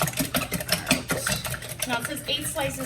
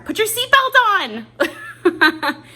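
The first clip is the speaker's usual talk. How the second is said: much higher, louder, slow mock scold; nagging car voice; 12 semitones higher, 12 dB louder, slower than usual. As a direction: shout it like a car alarm nagging you. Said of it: "It's like in the car".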